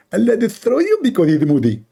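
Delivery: half-parody of a cleric; Zakir Naik impression. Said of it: "Zakir Naik".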